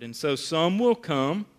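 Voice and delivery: ordinary talk, nothing marked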